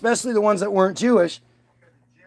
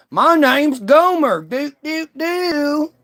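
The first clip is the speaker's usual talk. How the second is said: hammed-up hillbilly accent, high-pitched; mock a goofy Southern hillbilly; 6 semitones higher, slower than usual. Speaker mocking musicians in bluegrass costume, goofy hillbilly accent. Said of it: "mountain people that talked funny".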